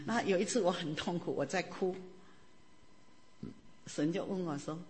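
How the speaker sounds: usual speaking voice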